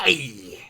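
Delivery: drawn-out yelp with a laugh; mock cry of pain. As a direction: wail an exaggerated ouch. (aïe crié en riant)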